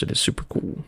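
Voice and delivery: breathy hushed whisper; softly whispered praise. Real, not acted. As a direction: lean in and whisper it. Recommending the game, voice dropped.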